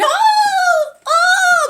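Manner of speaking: high, loud, held cry; imitated scream of pain. Heroine cleaning her cut with wipes, screaming in pain. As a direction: wail two long ohs. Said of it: "and she's screaming".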